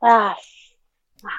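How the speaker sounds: usual speaking voice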